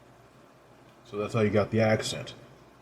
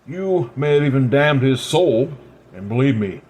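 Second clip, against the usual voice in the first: ordinary delivery, nothing marked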